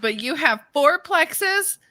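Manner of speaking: high, drawn-out mock disbelief; retold incredulity. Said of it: "I go".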